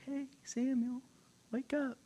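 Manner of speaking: high, hushed singling-out call; soft, high, gentle summons; God calling softly. Retold or imitated. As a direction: coax a sleeper awake softly. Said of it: "He's like".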